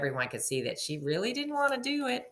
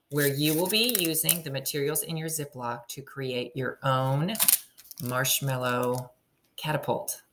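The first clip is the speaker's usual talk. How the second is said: ordinary delivery, nothing marked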